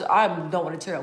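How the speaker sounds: usual speaking voice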